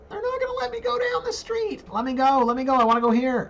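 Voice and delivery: very high, whining mock protest; mock tantrum. Host blocked from going down the street, high mock tantrum. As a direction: squeal it like a hammed-up tantrum.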